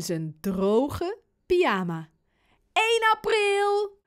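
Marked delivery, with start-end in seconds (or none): 2.7-4.0 high, loud, drawn-out triumphant yell; top-volume shout. A narrator's reading. Boy springing his April fool on dad, shouting. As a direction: crow it out long and high. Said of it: "roept hij keihard".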